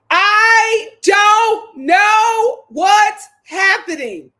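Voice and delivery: loud, high, very slow, word by word; drawn-out baffled outburst. Real, not acted. Host stunned by Clark and Coco's pick, slow loud bafflement. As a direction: stretch every word in loud disbelief.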